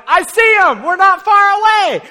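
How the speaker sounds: high, loud call from the rooftop; excited shout. Retold or imitated. Friend on roof calls down loudly to the others. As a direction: shout down the good news. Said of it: "one of the guys is like".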